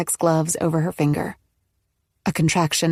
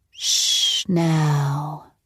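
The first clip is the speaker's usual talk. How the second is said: soothing. Acted. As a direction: hush her gently. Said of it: "she cooed".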